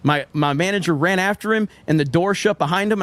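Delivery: ordinary delivery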